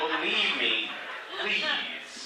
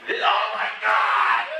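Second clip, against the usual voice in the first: mock terror; 4 semitones higher, 4 dB louder, slower than usual. Acting terror of own thumbs.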